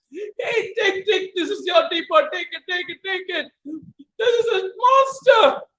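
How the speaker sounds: high, panicky hand-over; frightened robber. Acted. Robber frightened, handing the teapot back. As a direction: push it away in fear.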